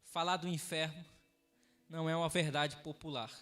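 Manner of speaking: low, soft, subdued pulpit voice; hushed, measured closing words; solemn sermon closing. Acted. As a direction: bring the congregation down quietly toward prayer. Solemn closing before prayer.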